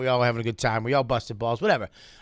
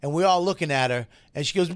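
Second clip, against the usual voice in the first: ordinary delivery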